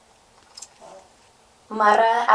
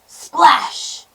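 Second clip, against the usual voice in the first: loud, high burst; sudden storybook splash; sound-word exclamation; 10 semitones higher, 8 dB louder than usual. A narrator's reading. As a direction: make the word hit like water. Troll hits the river.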